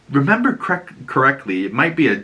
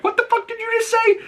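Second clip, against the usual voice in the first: much higher and fast, outraged; furious Ellie; faster than usual. Retold or imitated. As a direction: fire it back at the guy who insulted her. Ellie hears Seth's slur.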